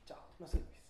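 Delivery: whispered, curt dismissal; dismissive aside. Acted. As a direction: brush him off under your breath. King dismisses crying soldier. (despedida seca y susurrada)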